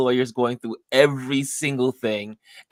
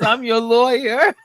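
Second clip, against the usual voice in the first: a lightly voiced lawyer, raised and amused; mock lawyer; 11 semitones higher, 7 dB louder than usual. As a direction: tell her plainly, through a laugh. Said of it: "He says".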